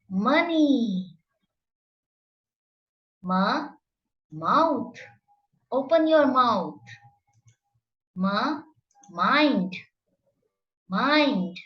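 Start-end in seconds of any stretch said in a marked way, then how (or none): none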